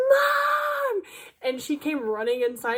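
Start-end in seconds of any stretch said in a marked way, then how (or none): 0.1-1.0 high, loud yell for her mother; screaming for mom. Retold or imitated. Scared daughter screaming for mom, high loud yell. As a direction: shriek for help across the house. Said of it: "I screamed as loud as I could".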